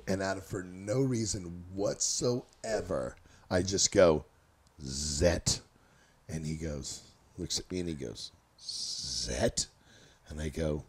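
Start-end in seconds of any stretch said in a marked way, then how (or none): 4.8-5.6 low, spaced-out blurt of Zet; deep weird word. Retold or imitated. Tripping friend blurts a strange word to buddy, low and spaced-out. 8.6-9.7 low, questioning Zet, deep and slow; puzzled echo. Retold or imitated. Buddy echoes the strange word back, puzzled and low.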